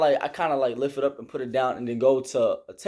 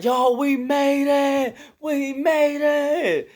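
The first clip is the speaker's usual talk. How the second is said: excited scream; 11 semitones higher, much slower than usual. Retold or imitated. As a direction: yell it out in triumph. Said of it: "we was screaming like".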